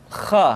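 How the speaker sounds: "Kha" rasped from the back of the throat; throaty kha. Shown as a demonstration. Teacher demonstrating the throat sound kha. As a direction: scrape "Kha" from the base of the throat. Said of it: "dikeluarkan dari pangkal tenggorokan".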